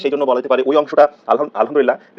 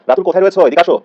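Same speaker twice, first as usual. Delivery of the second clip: raised, higher calling-out voice; calling someone; 4 semitones higher, 5 dB louder than usual. Acted. Teacher acts out someone summoning Ratul, calling out. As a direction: call over to someone, louder. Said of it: "কেউ ডাকলে আমরা বলি না".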